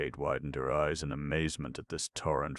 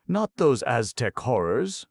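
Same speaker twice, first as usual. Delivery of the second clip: high, loud protest in a woman's character voice; Kate's dismayed voice; 8 semitones higher, 6 dB louder than usual. A narrator's reading. Baffled Kate answers young Mirabal, high dismayed protest. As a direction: object with raised pitch, rising at the end.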